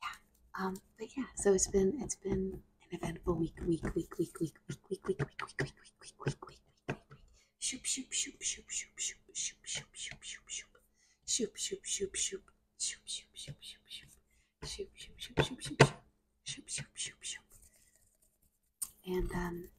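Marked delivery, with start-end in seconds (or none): 2.0-6.6 fast whispering asmr. Acted. 7.6-17.4 rapid whispered shoop sounds; fast whispering asmr. Acted.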